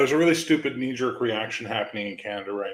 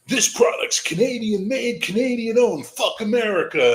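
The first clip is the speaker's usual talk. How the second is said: high, slow mocking voice of the boycott crowd; mocking Facebook posters; 12 semitones higher, 5 dB louder, slower than usual. Mocking Canadian boycott posts on his Facebook feed, high sneering parody. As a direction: sneer it out way up high like a smug patriot. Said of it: "my Facebook is just full of".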